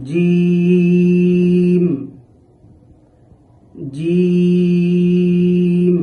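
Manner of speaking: teacher chanting জিম slowly; drawn-out letter demo. Shown as a demonstration. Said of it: "জিম হরফটি উচ্চারণের সময়".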